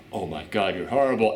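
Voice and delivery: imitating the shocked advisor. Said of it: "the woman's like".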